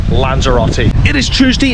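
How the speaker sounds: ordinary delivery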